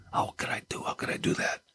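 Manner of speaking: whispered self-reproach; remorseful whisper. Acted. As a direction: ask it to yourself in a broken hush. David voiced in remorse.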